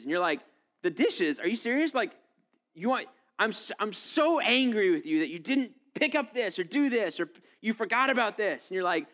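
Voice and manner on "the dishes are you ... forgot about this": indignant spouse. Acted. Acted spouse quarrel over dishes.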